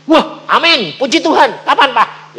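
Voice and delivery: high, loud happy amen; excited praise. Retold or imitated. Family praising God after the yes, loud and high. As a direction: rejoice out loud.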